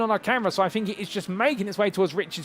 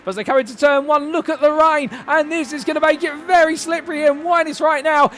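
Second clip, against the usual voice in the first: higher and louder as the rain hits; excited rain call; 7 semitones higher, 3 dB louder than usual. Real, not acted. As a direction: raise the pitch, the rain's arriving. Rain arrives, commentator gets excited.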